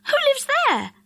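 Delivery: put-on curious surprised boy. A narrator's reading. Dick's surprised question.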